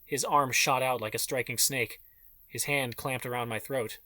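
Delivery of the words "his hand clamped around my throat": usual speaking voice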